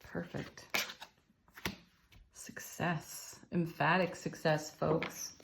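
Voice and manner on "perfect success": hushed whisper over the cards. Real, not acted. Tarot reader to herself over the drawn card, whispering. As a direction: breathe the words to yourself as the card turns.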